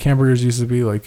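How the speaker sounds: ordinary delivery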